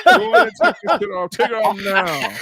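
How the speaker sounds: loud, high comic-dad command; hammed-up outraged father laying down the law; Bernie Mac. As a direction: bellow the order, dragging out the last part. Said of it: "I told her just like that".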